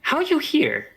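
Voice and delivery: high small voice inside his head; wondering high goblin voice in his mind; goblin girl's voice. Acted. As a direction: ask it in a tiny, high goblin voice. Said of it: "in your head you hear".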